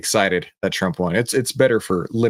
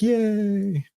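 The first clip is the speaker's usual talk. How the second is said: mock kids' cheer; 11 semitones higher than usual. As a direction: imitate children cheering from another room. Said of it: "they were like".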